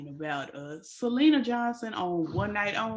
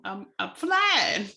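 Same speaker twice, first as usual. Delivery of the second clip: drawn-out mock wonder; acted-out amazement; 4 dB louder, slower than usual. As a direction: stretch out FLYING as if mid-fall. Acting out thought mid-fall.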